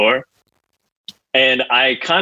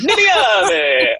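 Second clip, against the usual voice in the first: put-on Italian accent; 11 semitones higher than usual. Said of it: "[name] just immediately went".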